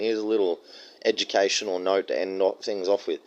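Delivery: ordinary delivery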